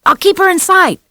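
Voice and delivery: put-on high, loud boy's call; boy shouting over his shoulder; calling back. A narrator's reading. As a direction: shout it while running.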